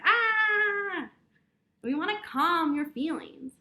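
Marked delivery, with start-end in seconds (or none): 0.0-1.1 sudden high loud cry of frustration; chaotic shout. Retold or imitated. Teacher showing kids what angry chaos sounds like, a loud mock cry. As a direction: burst out like a head full of chaos. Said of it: "everything in your head is like".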